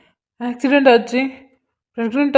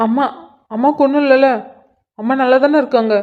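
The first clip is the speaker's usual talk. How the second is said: higher, urgent anxious questions; panicked son; 4 semitones higher than usual. Acted. Son questions his father about his mother, higher and panicked. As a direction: beg to hear she's alright.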